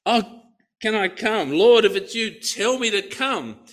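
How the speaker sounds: high, eager calling out to Jesus; eager cry out. Retold or imitated. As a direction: plead to be called across the water. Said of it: "cries out".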